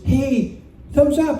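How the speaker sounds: woman's cheery signal, pitched high; imitated woman. Woman on the dock signalling to the rider, imitated woman's voice. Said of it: "and she goes".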